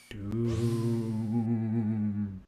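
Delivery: echoing doom. Acted. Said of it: "Evil laugh".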